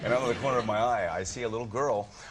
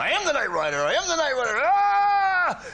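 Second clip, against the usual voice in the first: high, loud triumphant yell; mock bold shout; 8 dB louder than usual. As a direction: shout it like a hammed-up hero. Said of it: "and I went".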